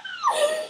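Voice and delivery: very high, squeaky gasps; overwhelmed gasps. Retold or imitated. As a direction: gasp as if dying of excitement. Re-enacting literally dying of excitement.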